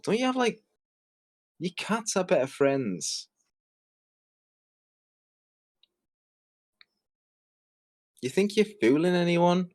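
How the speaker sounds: high-pitched, exasperated disbelief; incredulous. Real, not acted. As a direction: question them as if they were absurd. Incredulous questions to chicken lady.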